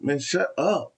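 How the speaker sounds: high-pitched irritated retort; retold annoyance. Storyteller replays telling laughing friends off, pissed and high-pitched. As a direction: re-enact snapping at the friends. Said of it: "I'm just getting pissed".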